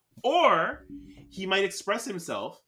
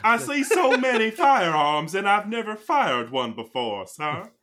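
naive churchgoer; 8 semitones higher, 8 dB louder than usual. Acted. Naive churchgoer role in skit.

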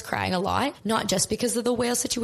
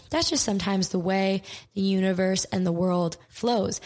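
usual speaking voice

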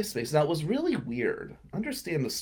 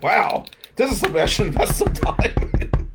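loud, high, hammed-up excitement; mock impressed; 6 semitones higher, 6 dB louder than usual. Guy reacting to a store clerk's message, mock impressed. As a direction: exclaim it like a sudden great discovery.

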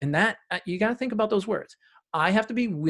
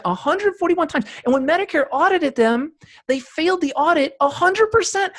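higher and louder, worked up over the numbers; incredulous; 11 semitones higher, 6 dB louder than usual. Retold or imitated. Guest telling the host about the failed audit, incredulous and higher. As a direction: sell the disbelief, pitch up and push.

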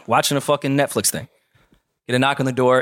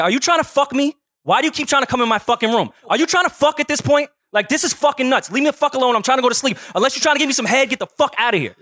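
high, loud yelling rant; heated hotel-door shouting at a drunk manager; retold screaming; 11 semitones higher than usual. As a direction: yell it right in his face to back him out. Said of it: "screaming really loud".

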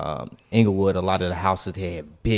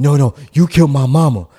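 insistent yell; 3 semitones higher, 8 dB louder than usual. Retold or imitated. Homeowner insisting, retold.

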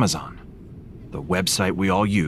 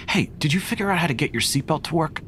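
whispering; 8 semitones higher, faster than usual. Acted. Whispered seatbelt exchange.

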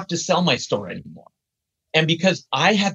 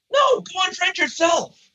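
high, slow, drawn-out mock shout; indignant retort; 13 semitones higher, slower than usual. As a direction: fire back in exaggerated indignation. Retort to an unfriending demand.

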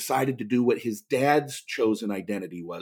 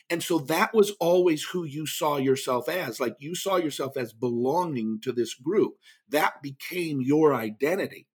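normal talking voice

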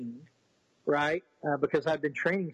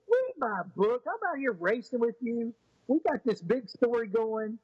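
a higher, lightly voiced doc teasing; mock the doctor pushing his book, pitched up; imitating the doctor; 7 semitones higher than usual. Doctor scolding, voiced in story.

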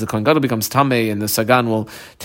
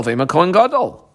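KOHEN GADOL lifted high like a question; high questioning tune on KOHEN GADOL; 6 semitones higher than usual. Real, not acted. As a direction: pose KOHEN GADOL as a challenge, pitch swooping up. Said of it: "question mark".

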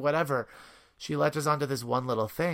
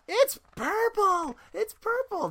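very high, drawn-out squeal of delight; mimicking a girl's emphatic, excited cry; emphatic excited little girl; 3 dB louder, slower than usual. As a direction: imitate a thrilled small child, pitch way up. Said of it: "very emphatically going".

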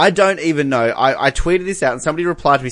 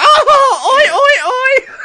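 very high, loud squeaky chant reply; imitated grandma; 6 dB louder, much slower than usual. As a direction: shriek the chant back as a hammed-up grandma. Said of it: "And she's like".

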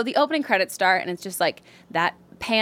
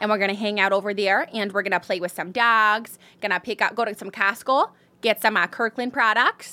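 Midwestern accent. Shown as a demonstration. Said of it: "like, Midwestern".

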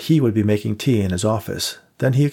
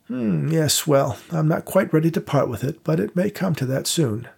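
put-on higher-pitched, genteel old man's voice; an old vaudeville house keeper showing guests around; old theatre manager; 7 semitones higher than usual. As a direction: play the elderly manager, courteous and unhurried. Victor answers Dave's correction.

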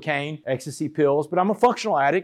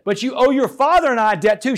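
higher, louder scolding as mom; imitating a mother's forceful rebuke; retold motherly reproach; 8 semitones higher, 6 dB louder than usual. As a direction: press the reproach home, pitch raised. Retold mother scolding her sentenced son.

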